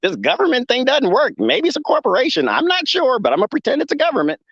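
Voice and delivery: a hint of a bewildered calf voice; confused newborn calf. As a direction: play a wobbly newborn calf, lost and unsure.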